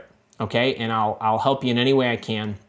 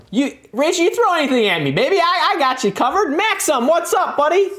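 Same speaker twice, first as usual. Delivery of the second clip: much higher, loud and bubbly; hyped greeting; 4 dB louder than usual. Real, not acted. Host excitedly greeting viewers in the livestream chat. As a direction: pump up your fans.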